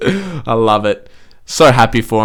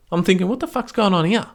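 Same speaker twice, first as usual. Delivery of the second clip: higher, stunned outrage; as if the call just came through; shocked disbelief; 7 semitones higher, 5 dB quieter, faster than usual. Retold or imitated. Fan retelling the overturned goal, disbelieving.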